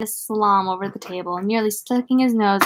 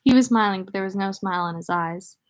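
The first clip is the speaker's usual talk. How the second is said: ordinary delivery, nothing marked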